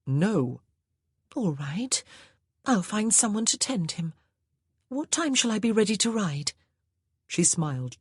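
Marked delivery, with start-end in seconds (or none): 0.0-6.5 put-on higher, lilting young lady; higher ladylike voice; wife's voice. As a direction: sweetly suggest the wine. Wife character speaking.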